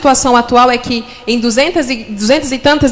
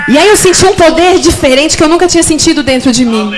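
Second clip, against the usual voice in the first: fiery preaching; 5 semitones higher than usual. Acted. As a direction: preach it out with fire. Preaching at full force.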